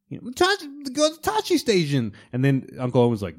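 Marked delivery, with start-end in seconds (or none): 0.3-2.1 put-on high whining boy voice; hammed-up whiny kid; whiny Luke. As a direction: whine like a spoiled farm boy. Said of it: "He goes from whiny little farm boy".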